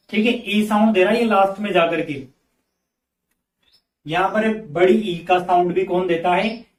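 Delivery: ordinary talk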